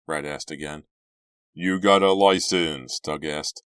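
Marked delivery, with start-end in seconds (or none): 1.5-3.0 slow, loud challenge; challenging. A narrator's reading. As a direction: ask it as a dare, drawn out and loud. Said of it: "sounded more like a challenge than a question".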